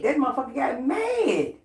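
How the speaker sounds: high, half-laughing shout; cackling shout about his anger; laughing yell. Real, not acted. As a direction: crow it out in delight. Gleeful retelling of his anger.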